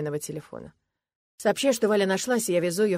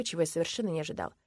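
ordinary delivery, nothing marked

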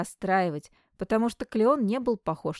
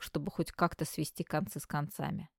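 ordinary delivery

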